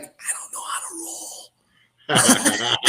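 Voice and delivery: slow, whispered through laughter; laughing whisper. Retold or imitated. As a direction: confess it slowly, giggling under breath. Embarrassed admission, laughing.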